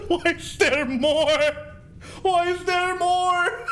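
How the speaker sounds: high, slow, laughing-crying demon wail; slow high crazed lament; possessed demon voice. Acted. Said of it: "demons kind of voice".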